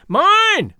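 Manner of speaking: very high, loud shriek; piercing drawn-out cry. A narrator's reading. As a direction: shriek it as a rival claim. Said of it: "shrieked another".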